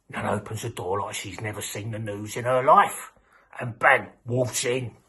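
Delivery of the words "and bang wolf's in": usual speaking voice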